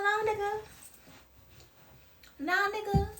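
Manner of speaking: high, sassy "nah" to a bad lover; 1 dismissive brush-off. Telling off someone who loves you wrong, sassy dismissal. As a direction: wave them off with attitude. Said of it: "be like".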